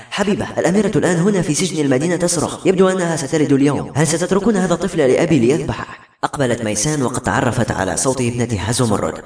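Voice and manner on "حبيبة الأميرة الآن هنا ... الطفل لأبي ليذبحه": high-pitched, anxious plea; frightened, very worried. A narrator's reading. Daughter warns through the mirror of a child in danger, frightened. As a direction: sound the alarm in a higher, worried voice. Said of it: "وهو يحمل خوفا وقلقا شديدا".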